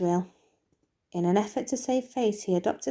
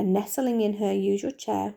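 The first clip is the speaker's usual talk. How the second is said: usual speaking voice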